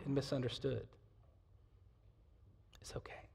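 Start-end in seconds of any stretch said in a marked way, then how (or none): 2.8-3.3 soft reassuring whisper. Real, not acted. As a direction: breathe it gently to the congregation. Reassuring aside after hard words.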